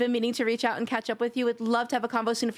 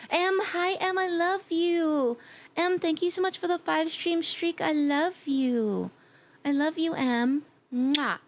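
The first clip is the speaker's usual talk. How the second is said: gushing affection; 6 semitones higher, slower than usual. Real, not acted. Greeting beloved friend in chat.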